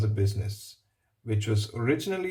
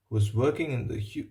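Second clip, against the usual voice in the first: plain reading voice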